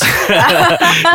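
sudden high laugh; loud burst of laughter. Real, not acted. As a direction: crack up laughing. Laughs at cohost's joke.

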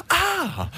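high yelp of pain; mock yelp. Swat member pricked by a rose, high yelp. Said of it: "just goes".

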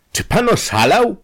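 high, loud outraged shout; angry shout. A narrator's reading. Industrialist shouting at the scheming detective, angry shout. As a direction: explode at the offer. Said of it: "krzyknął przemysłowiec".

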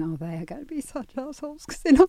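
high-pitched mock exasperation; exasperated parent. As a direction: grumble it high, like an unheeded parent. Parent voicing own exasperated muttering.

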